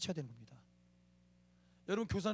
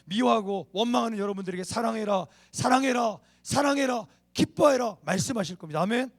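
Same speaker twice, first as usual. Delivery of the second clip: loud, building preacher's exhortation; fervent preaching; 4 semitones higher, 8 dB louder than usual. Real, not acted. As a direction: drive each command home, louder and louder. Preaches at full force.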